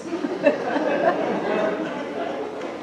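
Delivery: soft breathless scheming laugh; a quiet villainous chuckle at her own plan. Acted.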